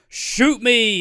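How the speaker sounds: high, loud, hammed-up dare; mock defiant shout. Daring gunmen with no bullets loaded, mock defiant shout. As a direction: yell it out as a mock challenge. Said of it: "you're just like".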